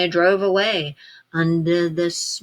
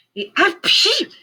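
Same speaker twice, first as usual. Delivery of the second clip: high comic sneeze; 13 semitones higher, 4 dB louder than usual. A narrator's reading. Lorax sneezing from the smog, comic sneeze. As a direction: let out a big wet sneeze. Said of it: "He sneezed and he snuffled".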